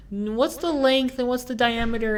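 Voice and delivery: put-on high, even old lady voice; old lady customer. Old lady asks her daughter about screws, high voice.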